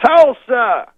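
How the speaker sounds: high, very loud stage shout; Bill Cosby. Retold or imitated. Cosby on stage making everyone boo, loud impression. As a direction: yell the rival's name to the crowd. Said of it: "Then he goes".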